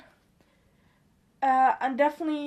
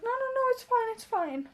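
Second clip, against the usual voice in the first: high-pitched mock backing off; hasty reassurance; 10 semitones higher than usual. Customer backing off from a manager, in a high imitated voice. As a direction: imitate someone hurriedly waving it away, high.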